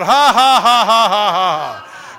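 booming, slow, deliberate belly laugh; forced laugh of faith. Shown as a demonstration. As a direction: force out big loud "ha"s. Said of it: "why don't you just laugh at it".

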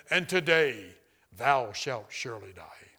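loud opening sinking into a low, slow pronouncement; deep, solemn voice. Retold or imitated. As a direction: intone it like a divine sentence, dropping low. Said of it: "it said in a very deep voice".

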